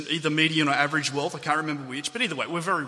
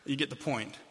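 normal talking voice

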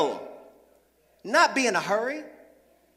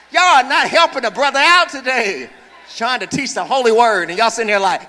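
loud, laughing mock complaint; laughing teasing; 7 dB louder than usual. Real, not acted. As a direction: tease them through a laugh. Teasing congregation for flat response.

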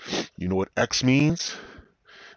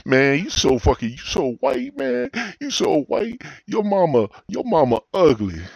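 high, louder fumbling insult; kid roasting; 10 semitones higher, 5 dB louder than usual. Retold or imitated. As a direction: scramble for a comeback, voice up high. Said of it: "we used to roast each other".

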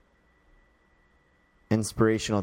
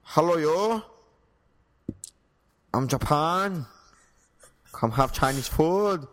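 high, caricatured Japanese accent; a confused, badly faked Japanese accent; 6 semitones higher than usual. Shown as a demonstration. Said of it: "do a Japanese accent".

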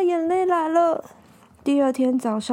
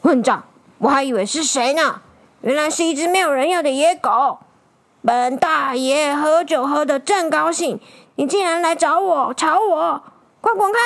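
put-on mean old man cursing in a huff; storybook villain's indignant tirade; angry scolding; 3 dB louder than usual. Drinking old man chases off the stray dog, angry scolding. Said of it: "气呼呼破口大骂".

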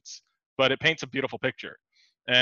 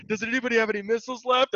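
mock call for help; 9 semitones higher than usual. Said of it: "we're always like".

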